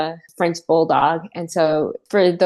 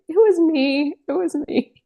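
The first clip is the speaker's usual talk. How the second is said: high-pitched caught-out admission; 11 semitones higher than usual. Retold or imitated. Admitting to the roommate that the snort was hers, high and caught out. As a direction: blurt it out high, like you've just been caught.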